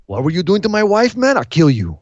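lightly acted jealous husband; a mock angry confrontation; Eddie Guerrero. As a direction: start high and indignant, drop to a threat. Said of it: "Eddie's like".

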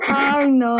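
laugh. Real, not acted. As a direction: crack up laughing. Laughs at her own joke.

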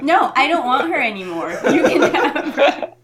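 mock sulk while laughing. Mock sulking, giving up pick.

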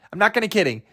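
normal talking voice

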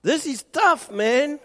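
high, loud, rousing statement of faith; realistic declaration. Shown as a demonstration. Preacher models the honest but hopeful words to say, high and loud. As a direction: proclaim it boldly upward.

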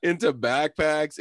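high, slow words with laughter in them; laughing. Real, not acted. Listing where the Jokers were hidden, still laughing. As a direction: keep listing slowly while still giggling.

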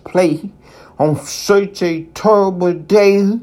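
incredulous, as if playing were absurd; Play? said in astonishment; surprised question. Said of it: "Pooh was surprised".

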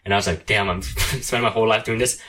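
usual speaking voice

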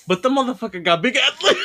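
amused mockery bursting into laughter; laughing punchline. Real, not acted. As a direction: deliver it as laughter takes over.

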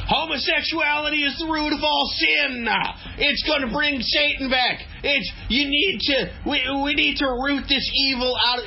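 high, shouted preacher voice; preachy zealot. Retold or imitated. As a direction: rant like a fire-and-brimstone preacher. Voicing fire-and-brimstone bigot, camp two.

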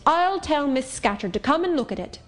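put-on a rough girl scolding in broad Cumberland accent; strong Cumberland accent. Monitor orders Helen to tidy her drawer, in Cumberland accent. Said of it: "exclaiming in a strong Cumberland accent".

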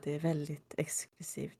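retold line in a hushed whisper. Kenzie to Nate about the party gig, whispered. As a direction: breathe it like a secret. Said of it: "Kenzie viskar".

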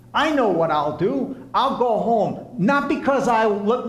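normal talking voice

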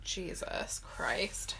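breathy whispered aside. Real, not acted. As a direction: whisper it to yourself.

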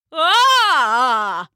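loud sudden cry of fright; narrated old man's frightened shout; startled shout. A narrator's reading. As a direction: yelp at the mouse. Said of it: "he shouted".

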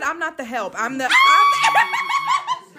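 laughing shout. Retold or imitated. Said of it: "She said".

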